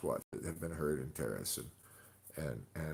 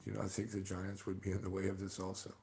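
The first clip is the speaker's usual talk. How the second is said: usual speaking voice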